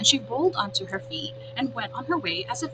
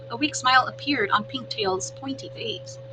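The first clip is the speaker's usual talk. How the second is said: ordinary delivery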